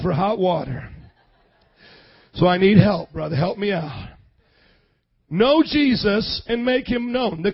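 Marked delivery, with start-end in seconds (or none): none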